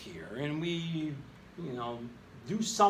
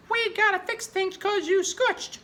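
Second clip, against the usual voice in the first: much higher and louder, slowed, panicky cartoon voice; freaked-out reaction voice; 6 dB louder, slower than usual. Teacher voicing a freaked-out reaction for the class, high squeal. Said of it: "and says".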